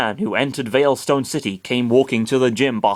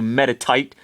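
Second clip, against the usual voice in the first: ordinary delivery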